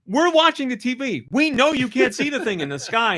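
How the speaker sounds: high-pitched mock pleading; exasperated insistence. Family watching the TV corrects dad, mock-exasperated. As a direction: argue with him, voice pitched right up.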